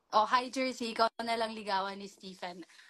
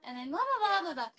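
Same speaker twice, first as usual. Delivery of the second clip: high, loud babble imitating your English voice; high-pitched English voice; 7 semitones higher, 5 dB louder than usual. Shown as a demonstration. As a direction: show off the higher English register. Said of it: "medyo high pitch".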